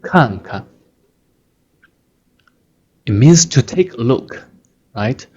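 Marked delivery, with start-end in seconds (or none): 0.0-0.6 看看 with a short, light second syllable; gentle push. Shown as a demonstration. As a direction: stress the first 看, soften the second 看. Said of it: "you give a gentle push".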